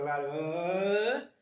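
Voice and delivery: "larga" stretched long with a rising end; drawn out. Real, not acted. Telling the interviewer about the place's long history, drawn out. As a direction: drag out "larga" to show how long it was.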